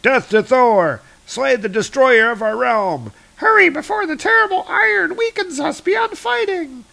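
high, shrieky battle cry; rally the troops in a high put-on voice; attacking dark elves. Dark elf troops charging at Thor, high war cry.

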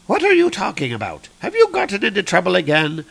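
lightly voiced scolding mother; Freya.

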